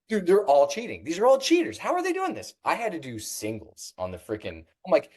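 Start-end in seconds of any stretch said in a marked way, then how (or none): 0.1-2.5 outraged disbelief. Retold or imitated. Retelling outrage at cheaters.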